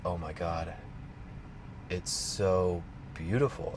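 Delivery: soft, slow, moved to tears; tearful awe. Retold or imitated. Before the psychedelic airport mural, soft, slow and tearful. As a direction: breathe it out, overwhelmed by beauty.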